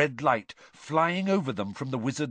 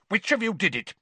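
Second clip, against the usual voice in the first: high, snapped accusation; curt, angry interrogation; 8 semitones higher, faster than usual. A narrator's reading. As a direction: snap it as a demand. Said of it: "he snapped".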